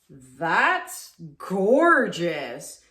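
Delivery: slow, loud, savouring awe; drawn-out gorgeous. Real, not acted. Painter marvels at her pour, slow awed delight. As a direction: breathe it out in real wonder.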